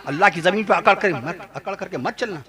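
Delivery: quiet echo of the warning. Shown as a demonstration. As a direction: let it fall away gently. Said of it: "repeated softly after".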